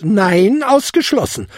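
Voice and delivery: loud, high, firm refusal; cutting in. A narrator's reading. Old lady refusing goblin a bed, cutting in. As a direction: interrupt with an emphatic no. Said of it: "unterbrach sie ihn".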